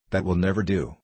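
usual speaking voice

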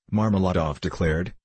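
plain reading voice

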